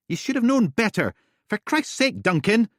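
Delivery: high, loud furious scolding; angry shouting. Acted. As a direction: tear into him. Ellie shouting on phone.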